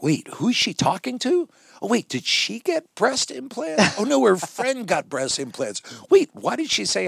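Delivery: high, rapid puzzled questions; high incredulous asides; bewildered wonder. Retold or imitated. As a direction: sound baffled and delighted. Retold own wonder at improv.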